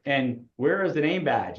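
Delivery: ordinary delivery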